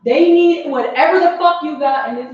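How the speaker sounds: loud, high urgent command; retold urgent order. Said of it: "I'm like".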